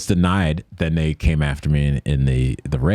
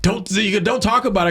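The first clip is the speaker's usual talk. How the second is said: mock agent; 14 semitones higher than usual. Agent's retold order.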